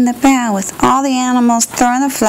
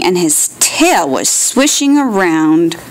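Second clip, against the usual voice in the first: ordinary delivery, nothing marked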